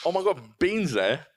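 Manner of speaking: high, slow, swooping wonder; hammed-up astonished delight; mock amazement. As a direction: play a gullible viewer spotting a star. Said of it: "The one like".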